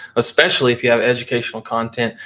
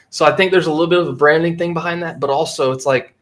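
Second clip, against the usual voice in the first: ordinary talk, nothing marked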